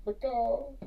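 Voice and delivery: high clucked punchline, lightly done; a touch of chicken voice. Acted. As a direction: squawk it like a small hen.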